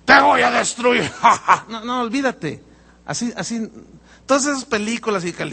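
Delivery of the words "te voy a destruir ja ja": loud evil threat with cackle; cartoon devil voice. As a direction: ham it up like a movie devil. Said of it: "pónmele un efecto ahí para no forzar mi garganta".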